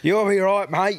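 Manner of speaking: high, brushing it off with a laugh; dismissive reassurance. Retold or imitated. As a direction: wave off the fuss, mock-cheery. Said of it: "we just went".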